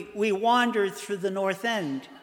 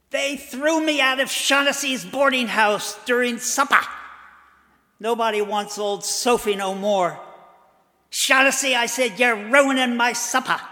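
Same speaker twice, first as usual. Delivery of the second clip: loud high boozy Boston woman; drunk old woman; 5 semitones higher, 7 dB louder than usual. Acted. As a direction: rant like an angry old drunk.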